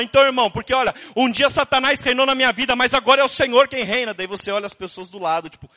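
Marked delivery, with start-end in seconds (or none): 0.0-4.1 loud, somewhat higher testimony blurted out; retold shouting. Brother loudly testifying to his pastor in a bakery, shouting. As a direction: belt it across the table so the whole bakery hears. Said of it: "ela grita, fala".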